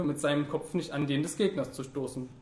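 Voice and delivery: usual speaking voice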